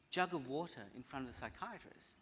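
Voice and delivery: ordinary talk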